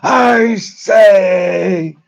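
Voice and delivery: high, loud Shakespearean shout; Olivier as King Lear. Retold or imitated. Olivier erupting as Lear once the lights hit him, shouting. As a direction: suddenly blast out Lear's lament. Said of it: "the lights were on him".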